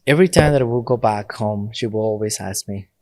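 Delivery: ordinary delivery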